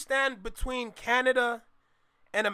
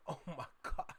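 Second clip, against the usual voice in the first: whispered laughing; 6 semitones lower, 15 dB quieter than usual. Real, not acted. Laughing at chat message.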